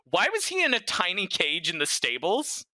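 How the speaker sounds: ordinary talk, nothing marked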